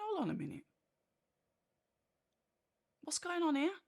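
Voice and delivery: high-pitched mock bewilderment; puzzled thinking aloud. Fight watcher thinking to himself at a surprise, high and puzzled. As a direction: voice a baffled thought, pitched high. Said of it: "So I'm thinking".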